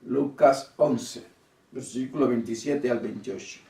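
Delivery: ordinary delivery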